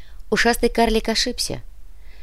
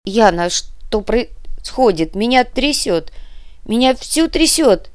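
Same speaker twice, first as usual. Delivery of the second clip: trembling panic; 4 semitones higher, 3 dB louder, slower than usual. A narrator's reading. Shaken witch cries on phone.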